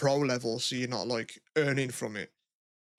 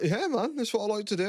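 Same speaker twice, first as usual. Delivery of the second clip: defiant reply; 8 semitones higher than usual. Retold or imitated. Retold reply to workmates.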